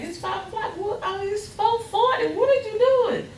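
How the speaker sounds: high, surprised questions at dawn; startled questions. Retold or imitated. Wife startled by husband dressing at dawn, high and alarmed. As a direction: pitch jumps up in alarm.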